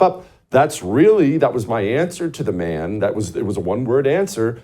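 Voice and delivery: ordinary delivery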